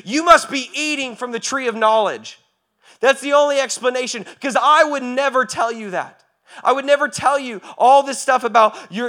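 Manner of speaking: high, loud preaching voice, rising to a peak; climactic preaching. Acted. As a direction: preach it hard, building to the top.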